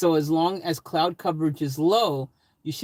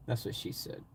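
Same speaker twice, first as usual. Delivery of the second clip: whispered aside; 6 semitones lower, 13 dB quieter than usual. Real, not acted. Mumbled joke aside.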